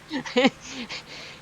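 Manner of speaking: high bursting laugh at himself; burst of laughter. Real, not acted. Laughing at own confusion.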